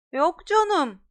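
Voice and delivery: Malfoy scoffing at Harry; scoffing bluff. Acted. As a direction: brush the threat off. Bully bluffing.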